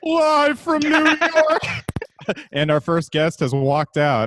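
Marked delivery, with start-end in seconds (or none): 0.0-1.6 slow, high announcer call. Pretend. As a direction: boom it out like a show opener. SNL opener mock announcer.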